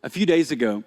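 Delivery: quiet, reflective telling; soft story narration. Acted. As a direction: lean in and remember. Soft storytelling after preaching.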